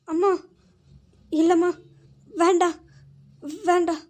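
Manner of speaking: desperate pleading. Acted. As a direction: cry out no to the mistress.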